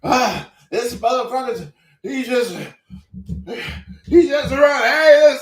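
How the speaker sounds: high, loud, rushed retold shouting; chasing the runner with the camera, excited yelling. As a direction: call out in a chase, half panicked. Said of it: "I said".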